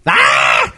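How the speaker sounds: sudden low unhinged yell; crazy shriek. Acted. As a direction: burst out with a crazed cry. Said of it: "Try to act crazy".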